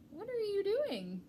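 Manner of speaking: high, slow curious mouse; small mouse voice. A narrator's reading. Mouse asking the pack rat, high curious voice. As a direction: wonder aloud like a tiny mouse.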